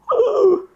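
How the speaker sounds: high-pitched mock gasp of shock; startled gasp. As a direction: gasp as if the big call just came. Retold shock at big phone call.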